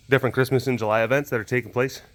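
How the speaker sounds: usual speaking voice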